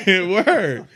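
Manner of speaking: loud, high word out of a laugh. Real, not acted. Guest sharing the joke with interviewer, laughing. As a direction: burst out laughing, then agree.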